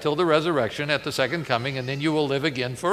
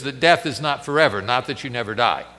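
usual speaking voice

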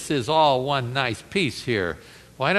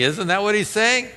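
ordinary talk

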